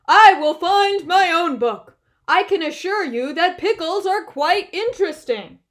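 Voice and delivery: high, loud insistent Bear; shouting. A narrator's reading. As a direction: keep shouting with indignant insistence. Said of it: "Bear shouted".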